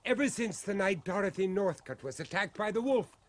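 can't hold back the hope; urgent excitement. Acted. Father sure his son lives.

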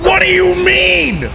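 high-pitched protest; outraged cry. Real, not acted. Player sent back by the game, outraged high cry. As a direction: wail it in disbelief.

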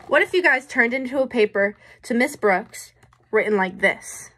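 usual speaking voice

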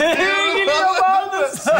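high, drawn-out mimicry; mocking echo. Friend mocking his mate's dating idea, high mocking echo. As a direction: throw it back in a stretched high mock voice.

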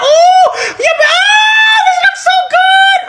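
high squealing delight; shrieking, giggling rapture; overexcited squeal. Acted. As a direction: squeal with laughing excitement. Excited over cooking video.

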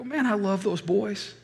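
high, tearful sigh of love; choked-up grandpa, voice up high; crying. Retold or imitated. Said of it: "both driving out of the airport crying".

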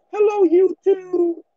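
mocking a bassless YouTuber's hello; voice with no bass. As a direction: drag it out high like a voice that never broke. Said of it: "No bass in his voice".

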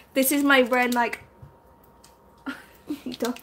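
ordinary talk, nothing marked

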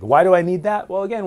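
normal talking voice

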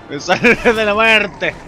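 loud, high laugh after a close call; relieved laughing yell. Real, not acted. Gamer just escaped death, loud relieved laughing.

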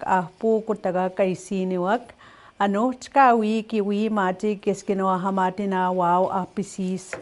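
fluent Cree, words run together like a native speaker. Shown as a demonstration. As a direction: say it the way a Cree speaker naturally would. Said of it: "how you would hear a Cree speaker saying it".

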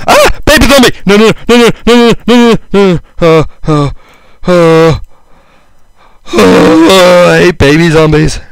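high, loud panicked shrieking; panicked yelling. Real, not acted. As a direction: yell in real panic as the zombie attacks. Attacked by baby zombie.

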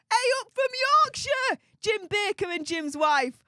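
high, loud call-out; hearty greeting. Real, not acted. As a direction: holler hello to far-off viewers. Calling a greeting back to viewers.

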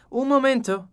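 high, loud sudden halt; agitated exclamation. A narrator's reading. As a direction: stop everyone, flustered. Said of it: "esclamò Poirot alzando una mano con aria agitata".

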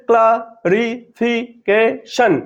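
"Clarification" slowly in five separate beats; syllable by syllable. Shown as a demonstration. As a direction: count out each syllable of "clarification". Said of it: "উচ্চারিত হয় পাঁচবারে".

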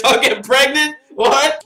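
high, loud disbelief; incredulous. Real, not acted. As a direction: blurt it out in shock. Stunned by line on screen.

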